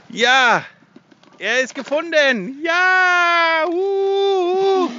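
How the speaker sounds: high joyful shout; excited cheer. Real, not acted. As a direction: cry out in triumph. Cache found, cheering.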